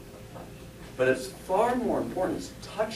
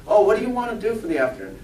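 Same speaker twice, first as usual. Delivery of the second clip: quick, higher, louder chatter; lightly voiced breezy tourist; chatty tourist; 5 semitones higher, 6 dB louder, faster than usual. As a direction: casually toss out a holiday question. Voices tourists chatting about plans.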